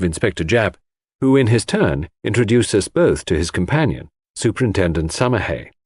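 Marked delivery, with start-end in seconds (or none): none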